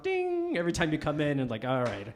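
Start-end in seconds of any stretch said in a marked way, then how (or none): 0.0-0.6 door chime. Retold or imitated. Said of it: "the door would ring".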